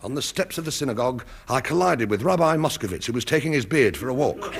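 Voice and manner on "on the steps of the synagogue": usual speaking voice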